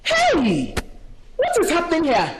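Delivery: loud scolding call; stern intervention. Acted. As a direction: break up the fight at full voice. Mother breaks up the quarrel.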